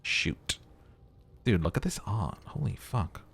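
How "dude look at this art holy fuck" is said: usual speaking voice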